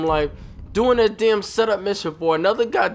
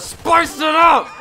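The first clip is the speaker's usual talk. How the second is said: hyped shout; 12 semitones higher, 5 dB louder, slower than usual. Real, not acted.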